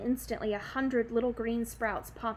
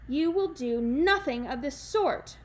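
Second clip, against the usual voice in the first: firm reply; 4 semitones higher, 6 dB louder than usual. Acted. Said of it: "Granny Relda replied".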